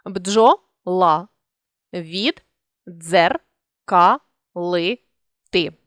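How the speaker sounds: бджо-ла-від-дзер-ка-ли-ти said syllable by syllable with pauses; teacher dictating syllables; split into syllables. Shown as a demonstration. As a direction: spell out бджоловіддзеркалити one syllable at a time. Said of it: "При співпадінні двох однакових звуків".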